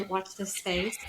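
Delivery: put-on light British accent on a catchphrase. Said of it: "I was doing my British accent".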